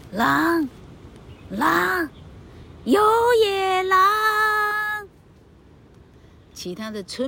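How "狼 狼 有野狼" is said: loud cries of wolf, climbing much higher; shouting wolf. Acted. Jack tricking the villagers, loud climbing alarm cries. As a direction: yell it to the whole village, rising to a peak. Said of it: "然后他大声地叫".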